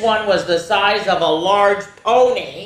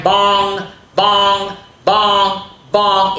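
ringing bong like a clock; 4 semitones higher, 5 dB louder, much slower than usual. A narrator's reading. Narrator sounds the clock chiming midnight. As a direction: chime each bong out. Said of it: "clock chimes imitated".

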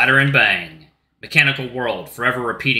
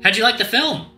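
high character voice; 11 semitones higher, 4 dB louder than usual. Acted.

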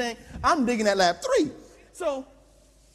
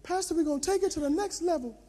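high, softer coach urging; trainer's voice; 7 semitones higher, 3 dB quieter, faster than usual. Acted. Trainer coaxing the tired pastor onward, high and soft. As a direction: coax onward in a raised voice. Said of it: "he said".

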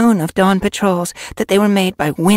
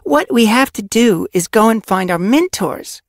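loud, emphatic telling-off, pitched up; furious; 8 dB louder than usual. A narrator's reading. Apprentice argues for fetching their mentors, furious. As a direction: argue forcefully, with controlled fury. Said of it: "voice was low and furious".

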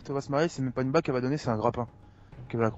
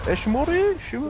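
put-on childish singsong; 12 semitones higher, faster than usual. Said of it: "c'est la façon qu'on dit".

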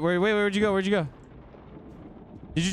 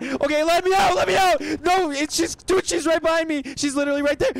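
panicked; 7 semitones higher, 3 dB louder than usual. Real, not acted. Panicked, chased by monster.

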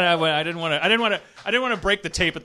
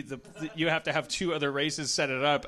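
ordinary talk

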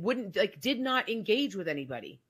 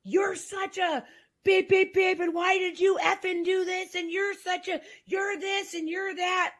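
mimicking husband's yelling; 9 semitones higher than usual. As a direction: throw the insults like a raging husband. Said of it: "he would be yelling at me".

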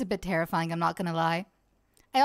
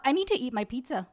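normal talking voice

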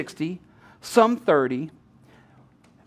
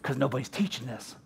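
lower, softer, matter-of-fact; low quiet voice of God; 10 semitones lower, 15 dB quieter than usual. Retold or imitated. God replying to the preacher's discovery, low and hushed. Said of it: "God's like".

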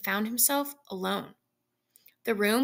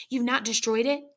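ordinary delivery

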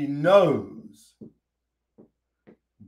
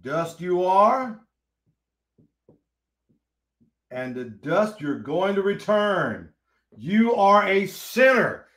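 slow solemn emphasis; slower than usual. Shown as a demonstration.

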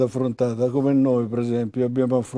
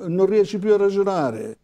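più stressed, voice raised high; emphatic repetition; 6 semitones higher than usual. Real, not acted. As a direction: lean hard on più. Repeated for emphasis, pitch high. (enfasi su più)

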